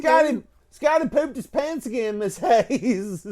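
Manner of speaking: high, sing-song tattle with pooped; tattling kid. Retold or imitated. Kid tattling to teacher Miss Hayes, high sing-song call. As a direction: tattle again, softened to pooped. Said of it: "he was like".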